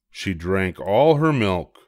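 plain reading voice

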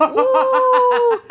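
high, loud woo; excited whoop. Real, not acted. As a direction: cheer it out. Hyping friend's lashes.

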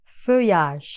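feuillage said slowly as fö-yaj; slow model word. Shown as a demonstration. Teacher repeating feuillage slowly for students. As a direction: draw out feuillage, the ll as y. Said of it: "Tekrar feuillage".

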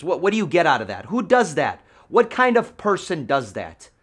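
loud, fast, exasperated questions; indignant rant. Real, not acted. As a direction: rant at the cheating seller. Ranting about being lied to.

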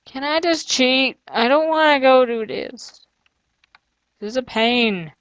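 real reluctant moan; whining reluctance. Real, not acted. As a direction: plead to get out of it, dragging the words. Fed up with the maze.

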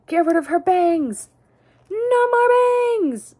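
high, slow, loud drawn-out declaration; mock triumphant cry. Removing the doll's bangs, a hammed-up high slow cry. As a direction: proclaim it like a battle cry.

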